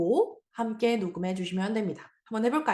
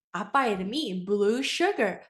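usual speaking voice

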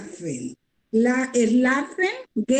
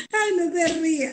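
higher, giggly protest; real laughing; 4 semitones higher, much faster than usual. Real, not acted. Student begs teacher not to laugh, giggling. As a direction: protest through your own laughter.

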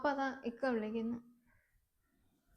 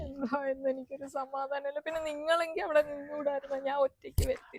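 weep while you protest; crying. Acted. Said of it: "നീ കരയണ്ട".

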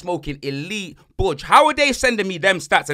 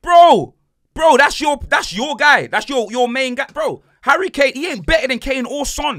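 incredulous outburst; 7 semitones higher, 8 dB louder than usual. Real, not acted. Mocking Arsenal's main striker.

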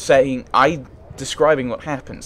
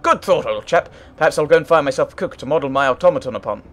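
quick, louder upper-class gentleman, lightly voiced; a hint of hearty English gent; hearty old chap; 2 semitones higher, 5 dB louder than usual. Artificer thumps the valet's back, lightly voiced heartiness.

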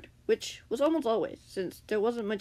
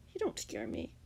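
soft, high defiance; defiant; 11 semitones higher, 6 dB quieter than usual. A narrator's reading. Captive Grian stands up to his captor, defiantly. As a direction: defy them in a small raised voice. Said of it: "Grian said defiantly".

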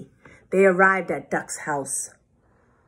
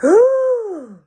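high, loud shocked gasp; sudden storybook "hooh" of shock; 9 dB louder than usual. A narrator's reading. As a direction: gasp in hammed-up astonishment. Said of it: "The animals gasped".

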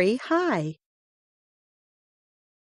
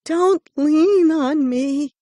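higher, louder complaint; loud protest; 8 semitones higher, 7 dB louder than usual. A narrator's reading. Bark creature loudly protests the hare leaning on it. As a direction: push the hare off with your voice.

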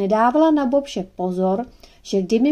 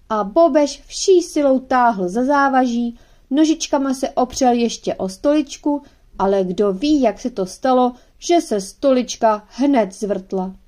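plain reading voice

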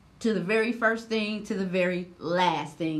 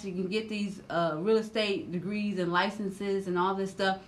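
ordinary delivery

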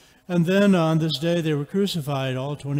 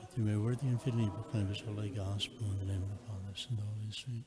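softer and lower, murmured; quiet murmured prayer; 5 semitones lower, 10 dB quieter, faster than usual. Real, not acted. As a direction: murmur the blessing under your breath. Priest's quiet private prayer.

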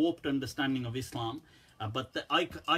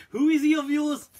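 high, loud laughing question; laughing loudly; 11 semitones higher, 8 dB louder than usual. Real, not acted. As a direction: burst out laughing through the words. Laughing at offended people.

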